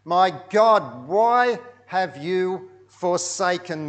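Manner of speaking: cried out high and loud, slowing at the end; loud anguished cry. Acted. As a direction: call out to God, forsaken. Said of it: "Jesus cried out in a loud voice".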